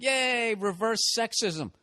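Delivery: high mock yay, slow sarcastic reverse sexism; sarcastic cheer. Laughing at the speech, mock-cheering. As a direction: cheer it up high, dripping irony. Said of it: "and I'm like".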